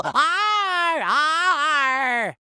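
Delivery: long drawn-out arrs, one after another; milk every arr like a pirate; gleeful stretched pirate cries. Acted. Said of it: "so I can say arr".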